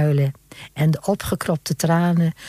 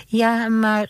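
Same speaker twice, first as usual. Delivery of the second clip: plain reading voice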